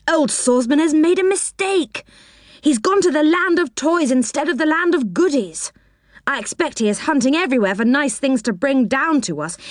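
high eager little man voice; Moonface. Acted. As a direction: chatter in a high, fussy voice.

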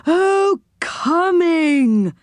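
high, drawn-out realisation; high quirky character catching on; dawning understanding. Acted. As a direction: let it dawn in a high comical voice. Saucepan man finally understands.

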